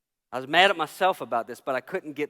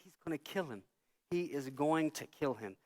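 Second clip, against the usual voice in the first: hushed alarm; 10 dB quieter than usual. Retold or imitated. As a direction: drop the volume in mock dread. Retelling his dread during fight.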